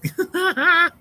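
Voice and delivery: high burst of laughing; laughter. Real, not acted. Streamer trying to boot this guy, high laughing fit. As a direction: crack up mid-sentence in a high cackle. Cracking up while booting a viewer.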